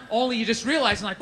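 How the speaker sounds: ordinary talk, nothing marked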